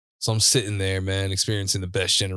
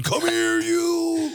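very high, echoing villain cry; imitated super-villain shriek; much slower than usual. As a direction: holler it like a cartoon super villain, stretching the last word. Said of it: "like, super villain".

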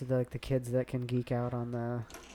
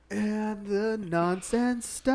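announcer voice; 8 semitones higher, 5 dB louder, much slower than usual. Pretend. Promo voice.

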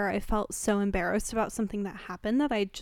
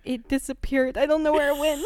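higher, louder, imitating a scared boyfriend; mock panicked partner; 5 semitones higher, 7 dB louder than usual. Partner admits the vibrator vanished, panicked. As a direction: blurt it out like a frightened teen.

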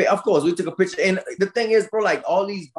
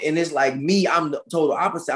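ordinary delivery

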